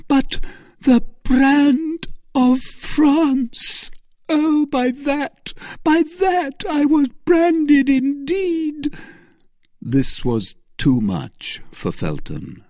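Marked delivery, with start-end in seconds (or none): 0.1-8.9 high, loud, slow cry of shame; anguished Milady. Acted. Milady crying out her shame to Felton. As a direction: wail it out as a branded woman.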